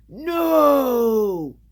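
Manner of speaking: long, high, loud cry of protest; desperate yell. A narrator's reading. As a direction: yell out in alarm, stretching the word. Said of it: "Buzz yelled".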